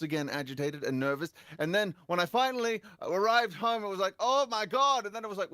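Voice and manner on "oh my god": high-pitched retold outburst; overwrought exclamation. Agitated moviegoer arriving home at last, overwrought exclamation.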